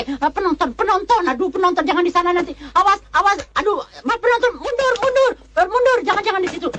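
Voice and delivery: very high-pitched, flustered warning calls; frantic warning. Acted. Director ordering onlookers back before the blast, frantic. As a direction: wave people back with urgent high cries.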